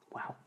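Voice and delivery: a short teary laugh breaking through; choked-up laugh. Real, not acted. Moved storyteller laughs as tears rise. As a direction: laugh through welling emotion. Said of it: "I'm welling up now".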